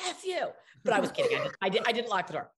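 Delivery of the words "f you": higher mock snap from Lars; playful comeback; mock retort. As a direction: director snapping back at a joke. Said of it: "he's like".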